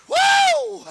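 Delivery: loud, high whoop of joy; ecstatic whoop. Real, not acted. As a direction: cry out overwhelmed. Overcome by the line.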